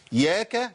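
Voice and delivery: ياك opened on y plus an a vowel. Shown as a demonstration. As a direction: release the y of ياك slowly into a. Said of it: "ياء متحركة بفتح".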